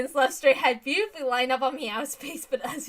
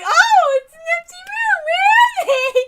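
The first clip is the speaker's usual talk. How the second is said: high, squealing mock voice, almost laughing; a shrill mock question that cracks into laughter; 13 dB louder than usual. Voicing characters in a scene.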